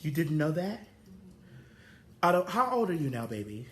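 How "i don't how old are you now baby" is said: ordinary talk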